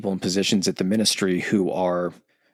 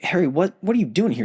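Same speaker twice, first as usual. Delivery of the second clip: higher, alarmed Hagrid voice, lightly voiced; 10 semitones higher, faster than usual. Hagrid, captured, alarmed to see Harry walk up. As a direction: call out in shock like a big friendly giant. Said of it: "And Hagrid's like".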